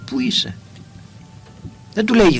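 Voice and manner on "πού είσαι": whispered "Πού είσαι; ", soft and plain; simple whispered call. Shown as a demonstration. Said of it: "Πολύ απλό".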